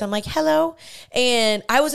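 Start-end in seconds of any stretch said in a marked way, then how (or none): 0.2-0.7 put-on high, cutesy toddler phone greeting; little baby voice. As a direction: answer the phone like a tiny kid. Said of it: "in my little baby voice".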